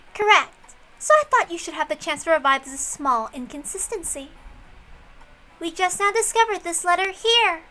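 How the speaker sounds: high, bright-edged voice, pleased with her own move; smug detective. Acted. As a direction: lord it over the table with a smile in the voice. Plays a female detective figure.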